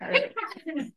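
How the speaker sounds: high Wicked Witch cackle. Pretend. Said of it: "that reminded me of, like, a witch laugh".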